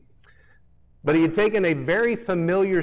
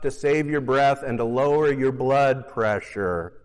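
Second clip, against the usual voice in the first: ordinary delivery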